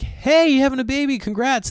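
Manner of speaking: high, chirpy congrats; mock congratulations. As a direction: gush politely at expectant neighbors. Congratulating expectant neighbours.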